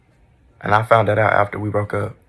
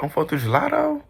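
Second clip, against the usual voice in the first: incredulous; 7 semitones higher than usual. Real, not acted. Disbelief at being ignored.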